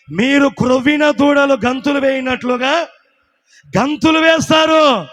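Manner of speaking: rising to a slow shouted close; preaching at full force. Acted. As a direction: build it up and shout the ending. Preacher proclaims promise. (బిగ్గరగా అరిచే బోధ స్వరం)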